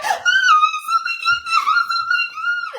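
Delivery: shrieking very high and loud with joy; squealing oh my God, laughing and teary; excited screaming. Retold or imitated. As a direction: scream it out, overjoyed. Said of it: "I start screaming".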